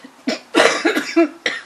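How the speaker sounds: sudden choking cough on gum. Real, not acted. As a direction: cough hard, caught off guard. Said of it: "Excuse me".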